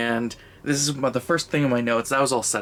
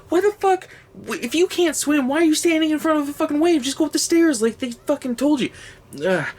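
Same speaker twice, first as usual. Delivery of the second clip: high, loud, incredulous yelling; fast, fed-up outburst of disbelief; shouting at the screen, high and loud; exasperated rant; 3 dB louder than usual. Real, not acted. Ranting at a dumb character.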